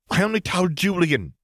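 high, desperate cry; panicked protest, pitched high. A narrator's reading. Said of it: "she cried".

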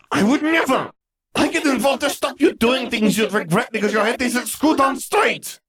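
very high, screamed tirade; shrill, furious outburst building to a shout; furious shrieking. A narrator's reading. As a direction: scream back at your sister in outrage. Gabby screams back in quarrel.

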